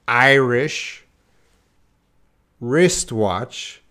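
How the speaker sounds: IRISH rising, long pause, WRISTWATCH; two isolated words, clearly enunciated; separate words. Shown as a demonstration. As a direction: say Irish and wristwatch one at a time, carefully. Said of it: "easy when they're separate".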